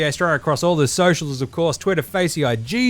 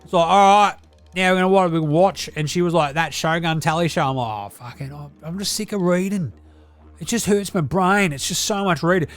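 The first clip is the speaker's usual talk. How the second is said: Deli character voice; 2 semitones higher than usual. Acted. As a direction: play the crude Aussie uncle reviewing telly. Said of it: "It's your old man Deli over here".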